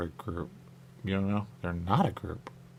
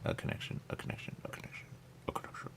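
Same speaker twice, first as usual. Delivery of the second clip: fading whisper; 15 dB quieter, faster than usual. Real, not acted. Repeating word fading out.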